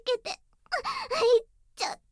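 high and loud, rushing to a peak; breathless, building to a climax; climactic cries. Acted.

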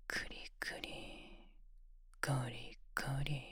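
close-mic ASMR whisper, commanding; whispered domination. Acted. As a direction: tease him in a cool, controlled whisper.

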